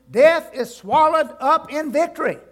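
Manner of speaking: loud proclamation. Shown as a demonstration. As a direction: shout it over the graves. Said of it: "I like to say this real loud".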